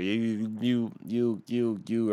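tripping repeatedly on "you"; stuttering, stuck on the first word; real stutter. Real, not acted. Ranting at a panhandler, real stutter. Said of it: "I stutter a lot".

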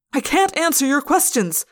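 high, loud protest; yelling. A narrator's reading. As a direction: shout back under pressure. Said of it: "I yelled".

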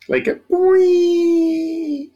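high, stretched-out cartoon boing; sound effect boing. Shown as a demonstration. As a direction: draw out a silly springy boing. GM imitates deflating noise.

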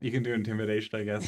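usual speaking voice

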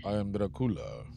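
lightly voiced, soft vampire count; a hint of Bela Lugosi's vampire voice, kept quiet; Dracula. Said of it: "we fucking Dracula sound like".

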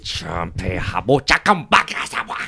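slow command in invented gibberish; made-up language. Acted. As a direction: draw out the mock foreign order. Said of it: "a language I will make up".

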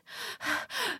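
sharp gasp for air; gasp, winded from riding; exhausted gasp before speaking; breathless gasp. A narrator's reading. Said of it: "The woman panted".

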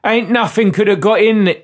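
broad, hammy Cockney sidekick; Cockney accent. Acted. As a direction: protest in a badly faked Cockney. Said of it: "as nuanced as this Cockney accent".

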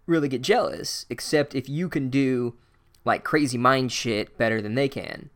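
ordinary delivery

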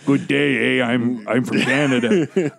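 mock Canadian accent. Podcaster jokes Wolverine should sound Canadian: hammed-up Canadian accent. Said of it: "Wolverine with the Canadian accent".

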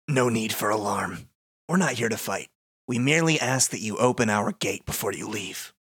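measured, slightly low villain voice; calm, ominous and deliberate; the darkness. Acted. Darkness demanding the gate from heroes, measured menace. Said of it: "I can be the darkness".

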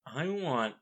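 ordinary delivery

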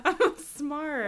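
mock smug. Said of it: "you're so cute and smart and quirky".

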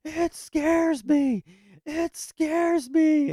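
very high, loud, slow drawn-out shriek; kid's scared yell. Retold or imitated. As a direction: wail it like a terrified little kid. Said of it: "yelling out".

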